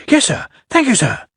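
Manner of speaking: lightly voiced happy young engine; happy little engine. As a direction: chirp it gratefully like a small engine. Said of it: "said Percy happily".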